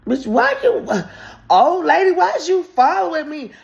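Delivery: high, loud shrill-free yell in imitation; hammed-up yell at the old lady; mock angry shout. Retelling the girl yelling at her on the escalator, loud.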